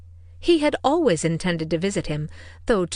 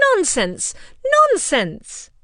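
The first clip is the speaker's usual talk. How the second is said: higher, louder double "Nonsense!" with falling tune; emphatic exclamation; 11 semitones higher, 6 dB louder, much slower than usual. A narrator's reading. Mother dismissing her husband, emphatic. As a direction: stamp each "Nonsense!" down. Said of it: "emphatic exclamation".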